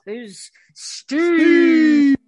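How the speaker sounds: loud, slow club announcer shout. Pretend. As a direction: bellow it like an MC introducing an act. Announcing a stripper on stage.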